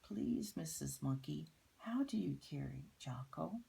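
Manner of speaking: usual speaking voice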